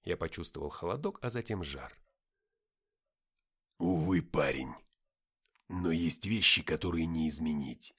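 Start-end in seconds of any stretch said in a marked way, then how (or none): 3.8-7.9 low, heavy character voice; weighty, low-pitched resignation; resigned victor. A narrator's reading. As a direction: break hard news in a low voice. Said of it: "начал говорить он".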